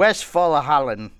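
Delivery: slow, drawn-out German Westfalen; hammed-up German accent on Westfalen; mock German accent. Host names the German state, in mock German accent. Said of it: "That's exactly how he sounds".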